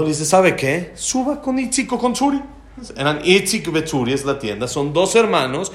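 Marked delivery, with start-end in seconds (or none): 0.2-2.4 exasperated retort pitched high; enojado, imitado. Retold or imitated. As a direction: act out a heated challenge. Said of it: "sigue enojado". (réplica exasperada en tono alto)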